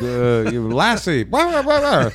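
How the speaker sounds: high mock dog yapping, laughing; high goofy dog noises breaking into a laugh; dog barking voice. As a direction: yap it out like a silly dog. Voicing dog barking for help.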